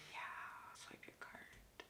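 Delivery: whispered, slow and flat-out indifferent; a barista's bored whispered card request; dismissive whisper. Retold or imitated. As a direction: brush her off in a breath. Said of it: "she was like".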